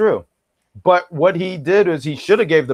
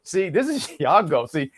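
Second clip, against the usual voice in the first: ordinary talk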